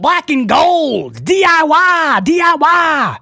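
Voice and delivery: put-on slow, drawn-out Southern accent, pitched high; playful Southern accent, stretched out high. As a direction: slip into a hammed-up Southern twang. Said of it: "I went full Southern there".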